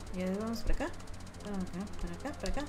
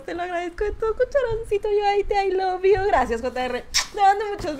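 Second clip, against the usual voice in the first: tearful gratitude; 9 semitones higher, 6 dB louder than usual. Real, not acted. Emotional thanks to donor.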